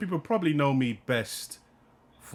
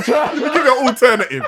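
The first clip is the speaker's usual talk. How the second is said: laughing mock shout, higher and louder; 11 semitones higher, 10 dB louder than usual. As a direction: yell it laughing, hamming up the actor. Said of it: "Man's giving alternative lines".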